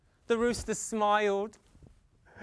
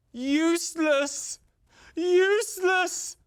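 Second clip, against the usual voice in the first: higher, louder, slow and scornful; scornful useless; 7 semitones higher, 4 dB louder, slower than usual. Acted. Farmer scorning the rooster, rising high and loud. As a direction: sneer it out, building.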